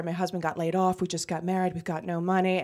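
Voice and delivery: low, soft poor-me plea; sob story. Acted. Contestant filling out the form to get picked, sob story. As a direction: play up the hardship, quietly. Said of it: "And I do the sob story".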